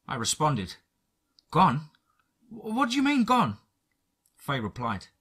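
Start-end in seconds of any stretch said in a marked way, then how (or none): 1.5-3.6 high stunned stammering question; disbelieving. Acted. Faye hearing Jimmy is gone, high stunned disbelief. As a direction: repeat gone in shock, stammering.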